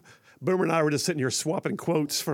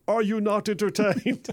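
mock arena taunt; 6 semitones higher than usual. Reading texter's punchline.

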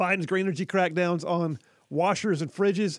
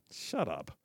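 low, quiet shut up; disbelieving mutter; 10 semitones lower, 10 dB quieter than usual. Real, not acted. Disbelieving aside to the report.

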